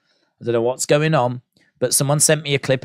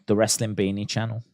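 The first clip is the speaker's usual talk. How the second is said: quiet aside; 4 semitones lower, 6 dB quieter than usual. Real, not acted. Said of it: "repeated softly".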